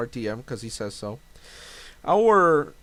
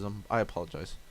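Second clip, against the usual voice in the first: ordinary delivery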